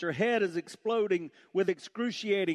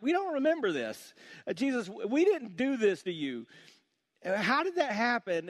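high-pitched, baffled mock objection; puzzled protest; 8 semitones higher, 4 dB louder than usual. People answering Jesus in the parable, puzzled protest. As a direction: protest in confusion, pitched well up.

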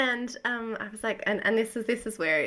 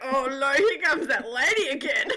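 mock parents; 4 semitones higher, 5 dB louder than usual. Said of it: "They're like".

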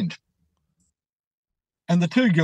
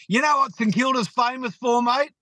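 higher-pitched footy heckle; imitated taunt; 8 semitones higher than usual. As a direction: act out a rival fan baiting someone. Retold taunt from rival supporter.

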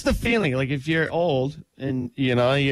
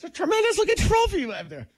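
put-on high, thin old geezer; high old man voice. Quoting an old man film line.

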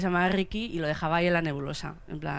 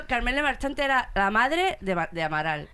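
ordinary delivery

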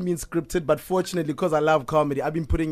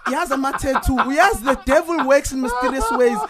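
high, louder laughing mom impression; imitating mom; 8 semitones higher, 4 dB louder than usual. Son mimics God-fearing mother texting scripture, high laughing mom voice. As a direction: lampoon your churchy mother, laughing through it. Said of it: "and she's like".

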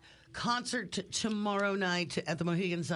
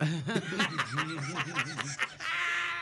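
long, real laugh. Real, not acted. Host tickled by the band's admission, long laugh. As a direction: burst out laughing and keep it going. Band admits making songs up.

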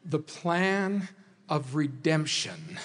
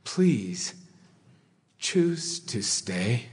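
low, slow plea with long pauses; gently implore, one weighted phrase at a time; earnest begging; 4 semitones lower, slower than usual. Acted. Jesus begging the unrepentant sinner, low earnest pleading. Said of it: "begging the unrepentant sinner".

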